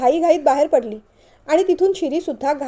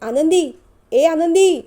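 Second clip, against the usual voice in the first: raised-voice call, pushing the second one harder; 4 semitones higher, 6 dB louder, slower than usual. Acted. Husband calls to his wife from across the house, calling out. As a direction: call out her name, higher and louder.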